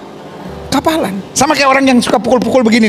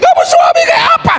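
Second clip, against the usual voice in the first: angry wife shouting; 8 semitones higher than usual. Retold or imitated. Wife scolding husband for defending the maid, furious tearful shout. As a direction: berate him, pitch soaring as you near tears.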